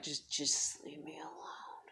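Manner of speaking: slow, drained whisper. Retold or imitated. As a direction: beg to be left alone, barely voiced. Exhausted mom to kid.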